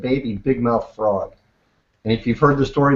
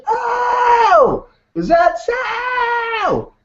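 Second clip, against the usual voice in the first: very high, stretched-out wail; big-mouth frog; much slower than usual. Acted. Frog answering the mama deer, big-mouth voice. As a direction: bellow it slowly in a high cartoon frog voice.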